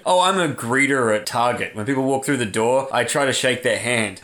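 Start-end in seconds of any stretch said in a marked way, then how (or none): none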